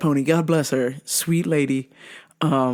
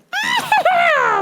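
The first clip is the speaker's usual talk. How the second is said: long high shrieking cackle; manic cackle. Retold or imitated. She comes in the store, manic high cackle. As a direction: mimic a crazed, shrieking laugh. Imitates woman in traffic.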